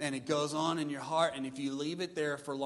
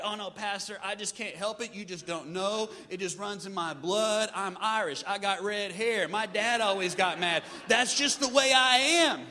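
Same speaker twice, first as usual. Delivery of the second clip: mock whiny excuse; 4 semitones higher, 6 dB louder than usual. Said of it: "congregant excusing anger, voiced".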